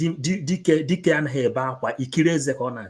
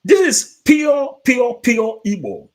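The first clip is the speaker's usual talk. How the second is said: much higher, louder, slow burst of delight; excited exclamation; 9 semitones higher, 7 dB louder than usual. Real, not acted. Preacher thrilled by the Igbo song he just played.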